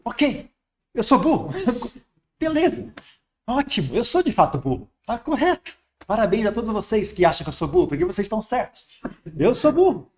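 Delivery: high, laughing mock surrender to being called dumb; playful high-pitched "fine, I'm dumb"; mock self-mockery. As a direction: cheerfully agree you're an idiot.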